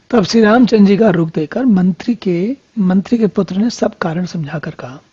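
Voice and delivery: usual speaking voice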